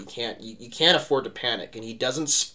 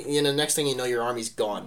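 ordinary talk

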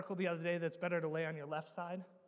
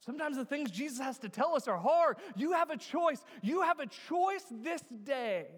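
raised, emphatic pulpit voice, stretching the last words; impassioned preaching; 5 semitones higher, 4 dB louder than usual. Real, not acted. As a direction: urge the congregation to choose. Preacher swells to full force.

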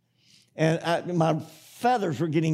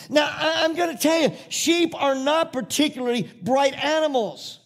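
full-force preaching; 7 semitones higher, 3 dB louder than usual. Acted. Preacher hammering the sheep lesson to his flock, fervent. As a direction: preach it hard to the congregation.

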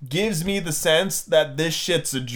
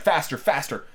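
ordinary delivery, nothing marked

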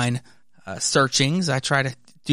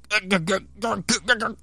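choked gugs; 7 semitones higher than usual. Acted. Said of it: "we have Mister Richards saying".

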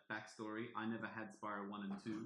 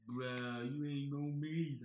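exaggerated surfer drawl; hammed-up surfer dude; Hunter; 2 semitones higher, 3 dB louder, slower than usual. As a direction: imitate a bro-ish surfer. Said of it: "a lot more exaggerated surfer".

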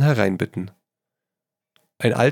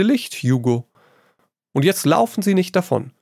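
higher, airy voice of the witty painter; light, amused artist lecturing his friend; Trevor, breezy painter; 7 semitones higher than usual. A narrator's reading. Painter Trevor teasing his friend Hugo, airy and high.

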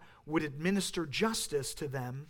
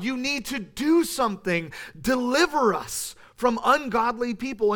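raised, higher urgent plea; pleading cry to God; 4 semitones higher, 6 dB louder than usual. Retold or imitated. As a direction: cry out to God, demanding he act. Psalmist cries out to God.

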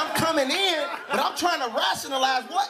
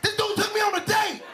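shocked realization; 9 semitones higher than usual. Retold or imitated. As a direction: shout it in sudden horror. Realizing the date, shouting.

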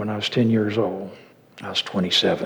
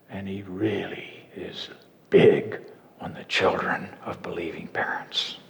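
a lower, breathy preacher's whisper; sudden whisper; 4 semitones lower, 6 dB quieter than usual. Acted. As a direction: drop to a low, hushed whisper.

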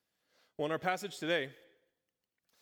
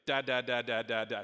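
lightly imitated baby babble, a bit louder; baby babbling; 3 semitones lower, 4 dB louder than usual. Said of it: "what I really heard was actually".

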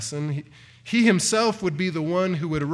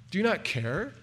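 softer, hurt and accusing; resentful reproach; 2 semitones higher, 4 dB quieter than usual. Acted. Frightened disciple blaming Jesus, softer, resentful reproach. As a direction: lean on not as a wounded accusation. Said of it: "a resentment directed at Jesus".

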